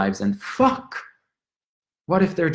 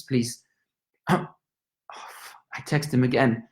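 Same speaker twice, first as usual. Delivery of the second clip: ordinary delivery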